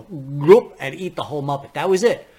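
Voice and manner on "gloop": loud swallowing gulp; a big puppet gobbling up a small one, loud; a loud cartoon gulp sound; monster gulp. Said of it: "come up from behind and go".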